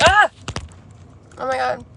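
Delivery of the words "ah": sneezing. Real, not acted. Sneezing, says sorry about sneezing.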